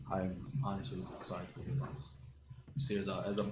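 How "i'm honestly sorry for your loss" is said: muffled, behind a mask. Acted. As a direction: speak as if through a mask. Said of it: "a muffled voice comes from behind the mask".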